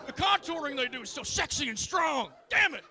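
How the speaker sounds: high, loud, ranting senator; worked-up senator. Retold or imitated. Comic playing a senator raving to an old lady, high and loud. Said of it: "the senator's just like".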